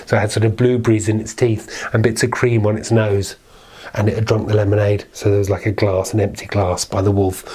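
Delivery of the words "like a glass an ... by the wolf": ordinary delivery, nothing marked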